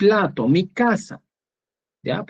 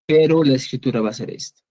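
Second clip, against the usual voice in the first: ordinary delivery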